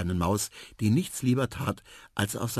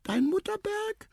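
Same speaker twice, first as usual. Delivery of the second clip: tiny, very high excited mouse. A narrator's reading. Mouse amazed at all the elephants, high excited squeak. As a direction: squeak it out high, piling up the hundreds. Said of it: "sagte Tiputip ganz aufgeregt".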